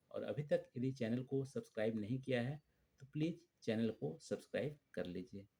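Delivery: normal talking voice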